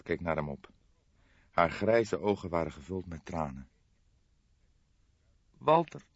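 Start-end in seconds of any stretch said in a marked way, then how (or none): 5.6-6.0 higher, sobbing name; sobbed. Acted. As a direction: break on the name as she sobs. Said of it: "snikte ze". (snikkend, veel hoger)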